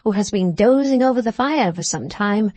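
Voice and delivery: ordinary delivery, nothing marked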